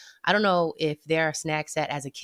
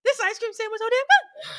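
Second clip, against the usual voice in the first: high excited rave. Real, not acted. Raving about ice cream.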